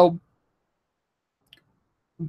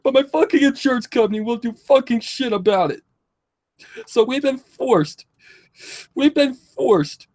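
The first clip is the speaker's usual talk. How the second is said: high, crying voice, real distress; tearful plea; 6 semitones higher than usual. Real, not acted. Young patient tells viewers they want to live, tearful. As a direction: plead through real tears, voice pitched up.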